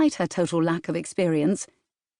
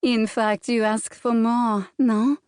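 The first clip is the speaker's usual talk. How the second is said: put-on French accent, rising higher, coy and teasing; 4 semitones higher, slower than usual. Celeste taunting Bram with his past, coy French accent. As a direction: tease him in a French accent, climbing up into the question. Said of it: "the teasing French accent".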